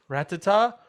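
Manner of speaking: high, slow sing-song calling. Retold or imitated. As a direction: call the pet's name, drawn out. Calling the dead mouse.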